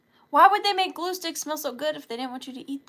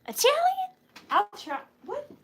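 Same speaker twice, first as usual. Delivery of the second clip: high startled yelp of a question; squeaky surprise; 11 semitones higher, 4 dB louder than usual. Real, not acted. Player hears the Italian item request, squeals in surprise. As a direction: react like the word caught you off guard.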